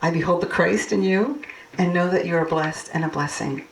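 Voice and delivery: ordinary talk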